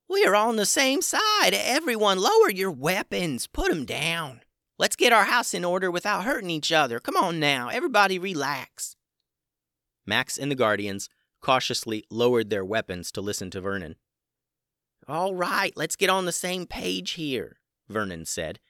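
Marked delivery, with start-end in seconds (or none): 0.1-9.0 high, loud little dragon voice; squeaky creature pleading for calm. A narrator's reading. Vernon calming everyone. 15.1-17.6 squeaky creature pleading for calm; high creature voice. A narrator's reading. Vernon speaking.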